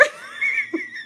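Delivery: squeaky burst of laughter; breaking into helpless giggles; high squealing laugh. Real, not acted. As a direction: crack up very high. Cracks up at own story.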